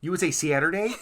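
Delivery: put-on Midwest accent on "Saturday", said "Saa-turday" with a flat open a; mock Midwest accent. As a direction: tease her Midwest "Saturday". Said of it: "You did have a Midwest Alaska".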